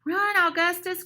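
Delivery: high urgent call; urgent cry. A narrator's reading. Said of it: "cries Lady Nell".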